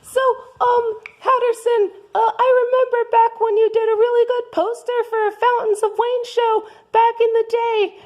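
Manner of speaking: high Martha voice. Acted. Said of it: "I am Martha".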